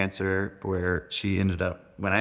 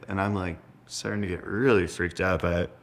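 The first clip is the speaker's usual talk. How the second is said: ordinary talk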